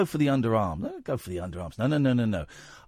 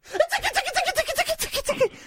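giggly tickle attack; much faster than usual. Pretend. Tickling the caller on air.

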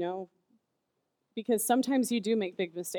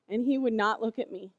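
ordinary talk

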